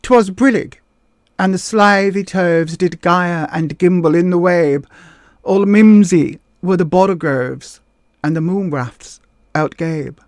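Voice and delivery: slow, theatrical verse recital; drama-school recitation. Shown as a demonstration. Would-be drama student showing off, slow theatrical recital. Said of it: "it would have gone something like this".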